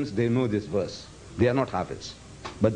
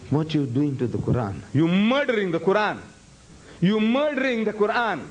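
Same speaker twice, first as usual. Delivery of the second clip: 1 mock scolding; 6 semitones higher than usual. Scolding the student's bad translation.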